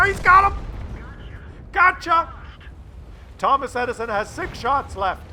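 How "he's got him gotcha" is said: high, loud triumphant call; excited cheer. Real, not acted. Commentator celebrating the player's kill, shouting happily.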